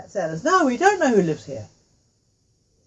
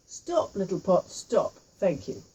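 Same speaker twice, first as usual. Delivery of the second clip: slow, deliberate incantation; slow spell; slower than usual. Acted. Old lady teaching Tui the stopping words, slow and deliberate. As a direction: say each word of the charm slowly and carefully. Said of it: "you have to say".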